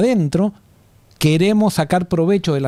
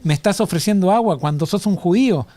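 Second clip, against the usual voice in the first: indignant sarcasm, pitched higher; sarcastic reproach; 3 semitones higher than usual. Acted. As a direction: reprimand him in mock disbelief. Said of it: "en esa actitud sarcástica lo estaba reprendiendo".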